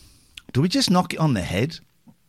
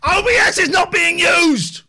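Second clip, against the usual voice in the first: angry shout; 13 semitones higher, 6 dB louder than usual. Real, not acted. Frustrated streamer yelling at chat.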